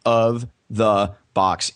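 very slow, each word set apart. Shown as a demonstration. Guest repeating his business name for listeners, slowly. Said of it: "I'm gonna say that slowly".

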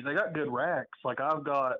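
ordinary talk, nothing marked

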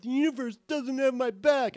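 mock the poor-me voice; a put-on woe-is-me whine; whiny self-pity. Self-pitying inner voice quoted.

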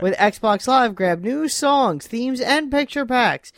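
loud, slow hammed-up ad read; announcer voice. Reads box blurb in announcer voice.

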